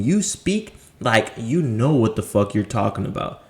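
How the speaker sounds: ordinary delivery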